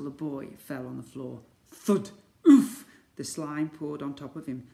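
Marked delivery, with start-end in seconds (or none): 1.7-2.1 loud, punchy sound-effect thud; voiced thud. A narrator's reading. Narrator voices the fall's impact. 2.5-2.9 loud, high oof; impact grunt. A narrator's reading. Boy hits the floor.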